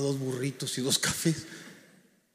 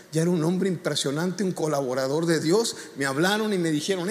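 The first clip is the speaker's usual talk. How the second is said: ordinary delivery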